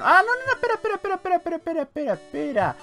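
high-pitched, frantic stream of words; sudden alarm. Real, not acted. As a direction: blurt it out high to halt everything. Suddenly stops himself mid-action.

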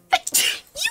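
sudden real sneeze; a sharp high sneeze; sneezing. Real, not acted. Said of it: "Excuse my sneeze".

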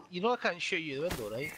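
ordinary talk

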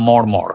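মর্মর with both r's as retroflex ড়, short and low; flapped ড় for র. Shown as a demonstration. Reciter testing a changed sound, short মর্মর with hard ড়. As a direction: say মর্মর as "moṛmoṛ", tongue curled back for each r. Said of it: "ডয়শূন্য র হতো".

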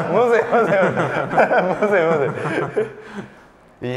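high, loud words through laughter; laughing helplessly. Real, not acted. Host fails at French Renault, laughing hard. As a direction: crack up while saying it. Cracks up failing at pronunciation.

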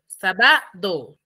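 slow sa-BA-do, middle syllable of "sábado" wrongly stressed. Shown as a demonstration. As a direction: drag out "sábado", leaning wrongly on BA. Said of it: "Es en la sa". («sábado» lento, con acento equivocado en la BA)

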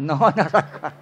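chuckling through the words; laughing answer. Pretend. Mock answer for the audience.

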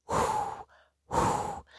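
soft airy "whoo" of wind; imitated night wind; wind sound. A narrator's reading. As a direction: blow a hushed, hollow whoo.

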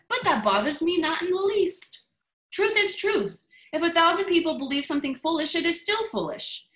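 high-pitched, assertive story-character reply; emphatic defiance. Acted. Columbus answering David Ponder's doubt, emphatic defiance. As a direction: declare it with lofty conviction.

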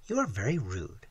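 soft, mildly hurt voice; near tears. A narrator's reading. Girl reproaching the narrator, near tears. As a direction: say it softly, tears just starting. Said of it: "with the tears starting to her eyes".

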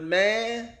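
ordinary talk

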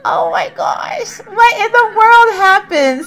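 real dismay at losing sims; upset. Real, not acted. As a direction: let the voice wobble in dismay. Second sim dies.

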